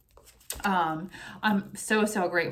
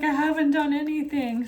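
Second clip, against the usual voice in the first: higher mock complaint from a bored kid; imitating daughter; 7 semitones higher, 4 dB louder than usual. Mother voicing her daughter's bored complaint, higher and pouty. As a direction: pout it like the daughter. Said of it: "And she's like".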